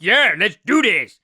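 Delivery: loud, stretched-out eager cheer; proud, eager kid. Retold or imitated. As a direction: play a kid pumped up and proud. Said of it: "All proud of myself".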